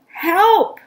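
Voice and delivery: high loud shout of help; calling for help. Acted. Missus Watson calling out for help again. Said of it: "Missus Watson called again".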